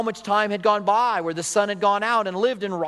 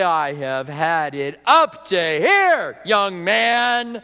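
mock parental scolding, building louder; exasperated parent; 4 dB louder, much slower than usual. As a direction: play a fed-up parent telling off a son. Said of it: "we get to a place and we say".

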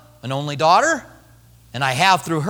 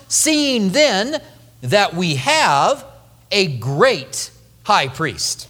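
seeing then that we have, drawn out slowly; slow, weighty reading; much slower than usual. Shown as a demonstration. Pastor reading the verse to the congregation, slow and weighty. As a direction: let each word of the phrase land slowly. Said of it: "that little phrase".

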